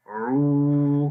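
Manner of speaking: "عو" pressed from the middle of the throat; teacher isolates the ain sound. Shown as a demonstration. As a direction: give "عو" a clear throaty ain. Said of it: "عین کی آواز گلے کے درمیان والے حصے سے".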